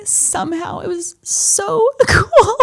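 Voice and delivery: normal talking voice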